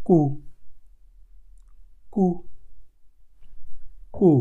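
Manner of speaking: কু said short, like the first sound of কিউ; কু said on its own, slowly; German Q sound. Shown as a demonstration. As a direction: give a crisp, short কু. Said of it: "কিউর উচ্চারণের সাথে একটু মিল".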